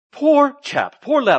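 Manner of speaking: high, loud mock posh English accent; playful English accent sneer. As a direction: pity him in a put-on English accent. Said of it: "I don't know if they had an English accent".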